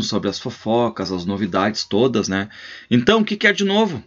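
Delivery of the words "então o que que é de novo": much higher, fast and eager; old rancher hungry for gossip from a visitor; nosy old host. Acted. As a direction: pump the visitor for news.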